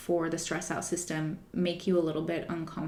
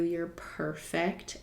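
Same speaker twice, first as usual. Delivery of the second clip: gentle reassurance; 6 dB quieter, much slower than usual. Retold or imitated. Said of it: "I'm like".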